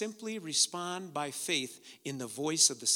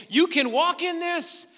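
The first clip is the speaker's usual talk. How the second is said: rising preaching; 10 semitones higher, 6 dB louder than usual. Acted. Preaching build.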